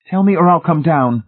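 high, loud, determined ultimatum; decided. A narrator's reading. Wife giving her trapped husband an ultimatum, decisively. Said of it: "The decision was there in her voice".

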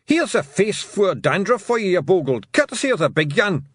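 high, loud Scots battle yell; tiny Scottish brawler yelling; fighting shout. A narrator's reading. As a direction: bellow a taunt in high-pitched broad Scots. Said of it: "Tiffany heard the man shout".

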